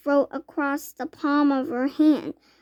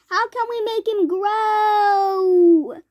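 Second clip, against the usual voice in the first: high, slow, loud sobbing plea; mother sobbing wail; crying wail; 7 semitones higher, 5 dB louder, much slower than usual. A narrator's reading. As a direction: beg through tears. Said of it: "wailed Missus T V".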